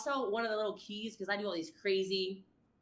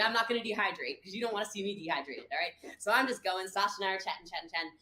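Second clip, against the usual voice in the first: ordinary talk, nothing marked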